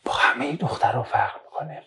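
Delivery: breathy half-whispered student imitation; imitating a student. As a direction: hush it like a shy boy confiding to his teacher. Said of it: "پسرها میان میگن".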